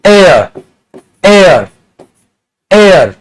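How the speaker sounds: एयर said with no h, starting straight on the vowel; silent H. Shown as a demonstration. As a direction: repeat एयर as a model, vowel first. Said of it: "ये पता है क्या होता है". (एयर, बिना "ह" के, स्वर से शुरू)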